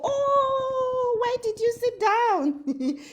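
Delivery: high, mocking voice of nosy people; mocking critics. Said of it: "they will go".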